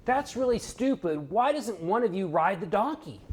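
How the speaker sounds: high-pitched heckler calling out; mock yell. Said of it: "someone yells".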